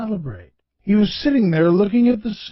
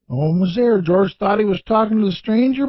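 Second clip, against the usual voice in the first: usual speaking voice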